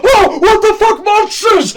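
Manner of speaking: high loud shout with a laugh; high loud panicked yell; terrified scream. Acted. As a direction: scream as the monsters are revealed.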